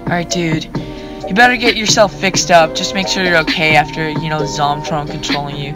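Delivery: usual speaking voice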